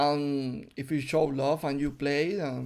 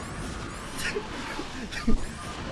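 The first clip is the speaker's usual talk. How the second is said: breathless laugh; 10 semitones higher than usual. Real, not acted. Caster laughs at the play.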